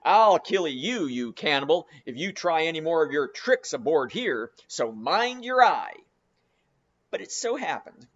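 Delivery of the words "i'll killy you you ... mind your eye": louder, menacing, slowing on the last words; captain warning Queequeg furiously; angry threat. Acted. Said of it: "roared the captain".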